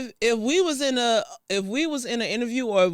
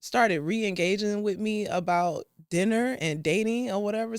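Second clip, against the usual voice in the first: normal talking voice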